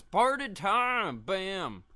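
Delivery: high, loud, long-drawn party call; gleeful slow shout; party time cheer. Real, not acted. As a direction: belt it out like a hype man. Celebrating lights flashing again.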